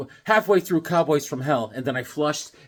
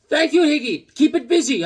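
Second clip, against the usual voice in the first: higher and louder mock clerk voice; clerk's goodbye; 9 semitones higher, 6 dB louder than usual. Clerk Amir saying goodbye, higher and louder. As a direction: wave him off as the clerk. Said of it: "I was like".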